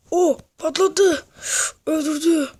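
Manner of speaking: loud, slow, stretched out exclamation; excited outburst. Real, not acted. Vlogger reacting to a stunt crash, loud excited shout. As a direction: blurt it out as the rider wipes out.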